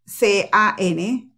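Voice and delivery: letters spelled slowly one by one. Shown as a demonstration. Teacher spelling the word slowly for students. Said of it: "las palabras".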